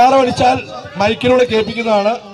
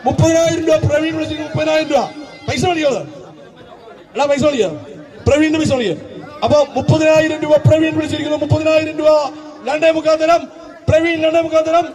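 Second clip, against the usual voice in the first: auctioneer; 4 semitones higher than usual. Acted. Auctioneer calling bids.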